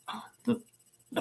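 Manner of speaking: whispered to herself; confused private whisper. Real, not acted. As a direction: breathe it under her breath. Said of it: "reading chat confused".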